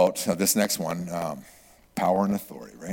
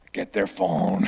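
normal talking voice

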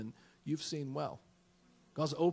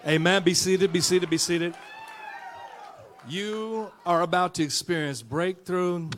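loud, slow, building preacher's cry; preaching at full force; 5 semitones higher, 9 dB louder than usual. Real, not acted. Pastor rousing the church to stand, full-force preaching. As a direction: drive it home to the congregation.

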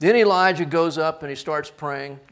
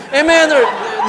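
ordinary delivery

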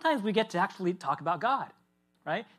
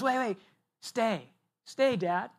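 put-on higher, little boy begging his dad to stay; a small kid clinging to his father's arm; pleading child; 5 semitones higher, slower than usual. Three-year-old begging dad to stay at bedtime, pleading child voice.

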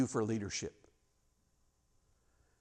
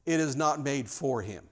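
ordinary talk

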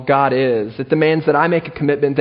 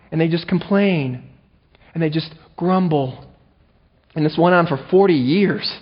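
usual speaking voice

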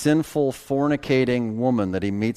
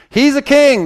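high, loud mock accusation; accusing cry; 14 semitones higher, 10 dB louder than usual. Preacher voices accusers denouncing Jesus, loud cry.

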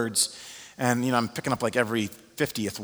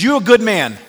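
much higher, much louder, proud; emphatic declaration; 10 semitones higher, 13 dB louder than usual. Retold or imitated. Alex telling the pastor he's good, loud and emphatic. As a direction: proclaim it with full conviction.

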